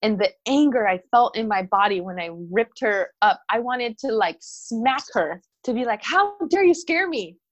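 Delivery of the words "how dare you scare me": mock angry. Mom's imagined angry words.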